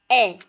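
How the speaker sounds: E sounded as an open "ê". Shown as a demonstration. Teacher showing the circumflex's sound, open è. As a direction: say "et" as è, mouth open. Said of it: "il fait aussi le son ouvert".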